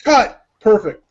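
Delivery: high, loud mock director's call; director calling cut. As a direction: sing out the end of the take. Director calls cut in sketch.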